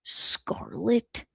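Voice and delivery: ordinary delivery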